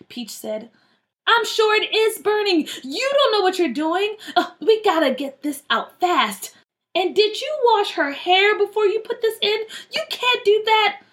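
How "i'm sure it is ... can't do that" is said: high, exasperated telling-off; alarmed scolding. Acted. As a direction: scold them urgently as a big sister. Big sister scolds the girls.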